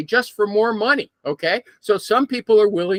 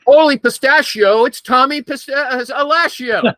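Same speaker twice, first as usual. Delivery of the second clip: lightly hammed announcer intro; announcer entrance; 5 semitones higher, 5 dB louder than usual. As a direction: boom it like a ring announcer, lightly. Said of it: "That's his new entrance".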